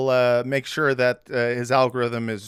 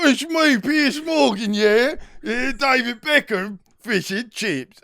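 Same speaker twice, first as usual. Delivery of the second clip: bad British accent; 9 semitones higher than usual. Mocking the TV host in a bad British accent. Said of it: "I can't do the accent".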